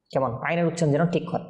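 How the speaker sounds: usual speaking voice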